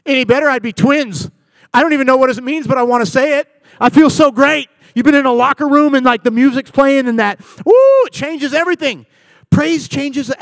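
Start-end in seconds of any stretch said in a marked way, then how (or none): 0.0-4.7 loud, high, exuberant boast; upbeat person. Acted. Cheerful one answering how they are, loud exuberance. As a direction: burst out with over-the-top good cheer. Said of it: "a room where everything's great".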